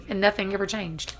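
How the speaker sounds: ordinary delivery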